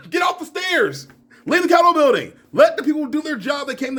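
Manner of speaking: high shout ordering rioters off the stairs; yelling at the Capitol rioters, high and strained; mock shout. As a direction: yell at the crowd to get out.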